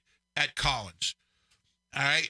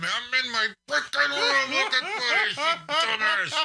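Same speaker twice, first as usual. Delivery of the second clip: higher, laughing mock scream; 9 semitones higher than usual. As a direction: vent at him half laughing. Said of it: "I wanted to scream at him".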